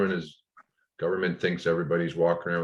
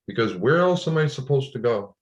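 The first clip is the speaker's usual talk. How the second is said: raised, higher voice in frustrated disbelief; exasperated outburst; 8 semitones higher, 4 dB louder than usual. Retold or imitated. Retelling arguing with the hotel clerk, exasperated raised voice. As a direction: push the question up and out, fed up.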